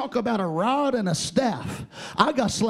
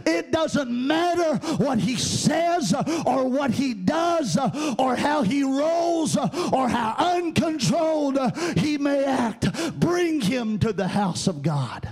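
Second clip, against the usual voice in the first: higher, driving cadence with gasped "uh" between phrases; full-force preaching; 4 semitones higher than usual. Real, not acted. Preacher tells the mother to bring her son, fervent preaching. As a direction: build phrase by phrase to a ringing cry, then drop on the last.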